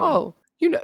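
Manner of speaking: high, breezy imitation of a friend; imitating friend. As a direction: imitate someone assuming it's common knowledge. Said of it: "And you're like".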